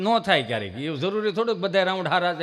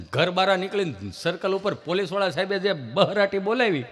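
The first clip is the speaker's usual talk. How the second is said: usual speaking voice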